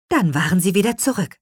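usual speaking voice

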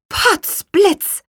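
high, loud cry of outrage; astonished, high-pitched oath; exclaimed. A narrator's reading. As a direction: call it out sharply. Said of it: "rief Petronella".

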